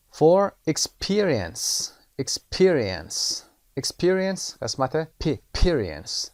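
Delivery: Experience with pe said loud and drawn out slowly; stress on PE. Shown as a demonstration. Teacher drills Experience for the class, PE stressed. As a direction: lean on the pe in Experience.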